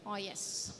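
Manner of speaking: normal talking voice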